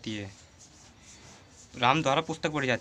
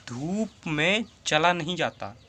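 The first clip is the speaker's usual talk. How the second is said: slow dictation; slower than usual. Shown as a demonstration. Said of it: "teacher gives new example sentence".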